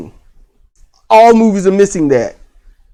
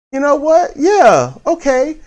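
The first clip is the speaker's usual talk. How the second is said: lightly imitated onlooker, pitched high; mock acquiescence; 11 semitones higher than usual. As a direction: play a local humoring them, voice raised. Voices a Japanese person's reaction.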